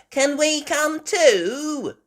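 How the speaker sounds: put-on high, slow little girl piglet; squeaky young piglet asking. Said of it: "Peppa said".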